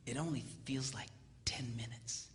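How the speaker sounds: soft, low, almost whispered; understated low aside; hushed deadpan. Retold or imitated. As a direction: drop it low and soft, dryly. Hushed deadpan before punchline.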